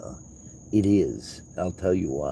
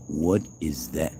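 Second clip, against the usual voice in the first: ordinary talk, nothing marked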